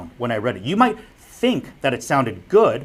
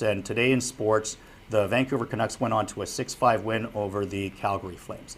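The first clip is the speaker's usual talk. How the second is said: boring monotone; 4 semitones lower, 6 dB quieter than usual. Shown as a demonstration. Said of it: "They just talk like this".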